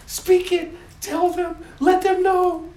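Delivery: high, loud thrilled urging; bursting with eager joy; excited God. Acted. God urging the angels on, thrilled high voice.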